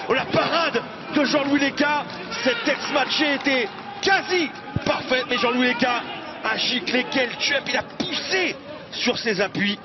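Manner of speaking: high, shouted save call; excited sports commentary. Acted. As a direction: roar out the keeper's save. Commentator calls a big save.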